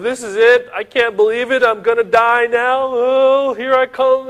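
high, loud mock wailing. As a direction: wail in acted despair. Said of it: "he's wailing, he's weeping".

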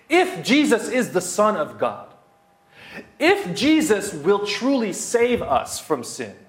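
mounting pulpit cadence on if; preaching build. Acted. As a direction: build each if clause like a sermon climax.

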